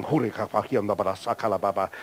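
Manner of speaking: low, soft mumbled tongues; low gibberish prayer, kept to yourself; mock praying in tongues. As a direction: imitate people praying under their breath with heads down. Congregation praying in tongues, heads down.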